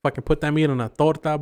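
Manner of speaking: usual speaking voice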